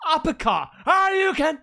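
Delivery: high, loud pretend punch yell; mock fight shout. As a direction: throw the uppercut with a shout.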